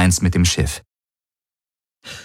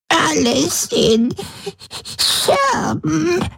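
much higher, slow whimper; an old woman whimpering in despair; much slower than usual. A narrator's reading. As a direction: whimper it out broken. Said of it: "wimmerte sie".

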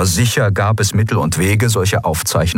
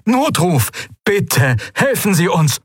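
high, desperate plea by radio; high-pitched emergency plea; pleading distress caller; 11 semitones higher, slower than usual. A narrator's reading. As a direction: beg for rescue in a high voice. Stricken captain pleads for help.

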